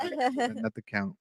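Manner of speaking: high, slow mocking squeak. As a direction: squeak it out slowly to mock. Mocking correction.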